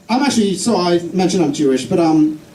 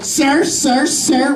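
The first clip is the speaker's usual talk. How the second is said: higher-pitched, insistent calling for attention; mock pestering customer; 6 semitones higher, much slower than usual. Lady customer trying to get the shoe seller's attention, insistent. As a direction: imitate a customer nagging for service.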